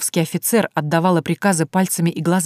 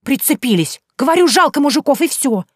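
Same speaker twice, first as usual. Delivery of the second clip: high, louder, huffy retort; sulky girl; 9 semitones higher, 6 dB louder than usual. Acted. Vaska defends herself, high and loud, huffy. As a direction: pout and snap at them.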